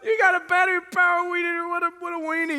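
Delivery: high, fast, scoffing ridicule; a preacher mocking a friend's weed eater, high and scoffing. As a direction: sneer at the battery weed eater. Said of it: "jump on the bandwagon and be like".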